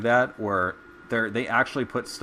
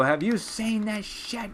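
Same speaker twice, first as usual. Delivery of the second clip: pissed janitor; 10 semitones higher, slower than usual. Retold or imitated. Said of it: "they're pissed".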